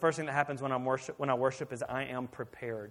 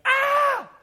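loud, high startled scream; imitated scream of fright; 14 dB louder than usual. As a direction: shriek like a frightened girl. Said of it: "I heard another yell".

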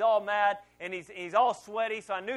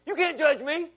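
much higher, louder, a sharp defensive protest; indignant outburst; 8 semitones higher, 10 dB louder than usual. Retold or imitated. Pastor blurting defiance at his wife, indignant and loud. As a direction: snap back like a stung husband. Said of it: "my initial reaction was to say".

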